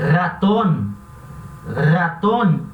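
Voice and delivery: ratón com R forte e vibrante no início; rolled R. Shown as a demonstration. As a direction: vibre o R de ratón com força. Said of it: "esse R vai ter esse som vibrante". (R vibrante em ratón)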